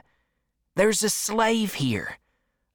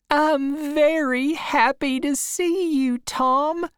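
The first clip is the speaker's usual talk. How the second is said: put-on higher, fond old aunt; Aunt Sally's voice; 10 semitones higher than usual. A narrator's reading. Aunt welcoming her nephew at the door, higher and fond. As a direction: gush over the boy like a doting aunt.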